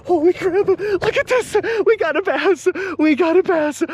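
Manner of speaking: high, delighted cries; excited. Real, not acted. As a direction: cheer with joy. First bass caught.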